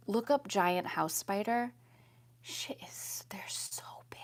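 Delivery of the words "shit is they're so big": soft breathy whisper; hushed whisper. Real, not acted. Whispering to viewers how big they are. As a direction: whisper it for emphasis.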